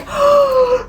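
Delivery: sharp high gasp of fright; startled gasp. Retold or imitated. Imitating a startled cyclist gasping at her car.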